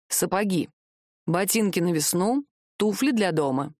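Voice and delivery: measured list, item by item. A narrator's reading. Sorcerer listing the order to the shoemaker, itemizing. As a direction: list each "Сапоги", "ботинки", "туфли" apart with pauses. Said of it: "перечислил колдун".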